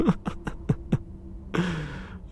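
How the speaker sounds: airy high cackle; breathless high laugh. Real, not acted. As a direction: lose it after the punchline. Laughs at own punchline.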